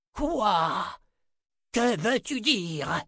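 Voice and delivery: high, slow, imperious crone; thundering old woman. A narrator's reading. Old mother to cowering daughter, imperious demand. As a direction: demand it sternly, drawing out the words. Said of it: "tonna la vieille femme".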